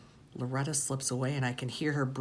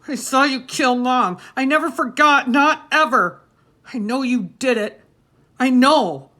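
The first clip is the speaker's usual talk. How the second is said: high, loud, desperate cry; screaming accusation; 10 semitones higher, 12 dB louder than usual. A narrator's reading. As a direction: hurl the truth at him. Boy screams accusation at father.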